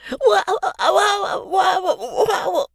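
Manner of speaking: put-on terrified little boy with pinched nose, high and squeaky; pinched-nose terrified squeal. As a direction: squeal through a nose being squeezed. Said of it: "too congested and too terrified".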